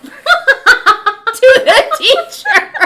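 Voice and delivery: high, loud words through laughter; real laughing disbelief; helpless laughing. Real, not acted. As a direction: squeal it out while cracking up. Cracking up at her own story.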